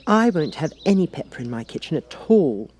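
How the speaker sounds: put-on high, slowish girlish musing; a girl working out a new rule; young Alice. A narrator's reading.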